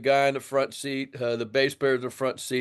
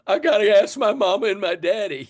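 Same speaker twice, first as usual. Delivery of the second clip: put-on higher, louder, a kid asking his parents' leave; like a little boy; 8 semitones higher, 5 dB louder than usual. Telling the host he answered like a little boy, lightly voiced. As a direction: play the boy who has to ask mama first. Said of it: "like a little boy".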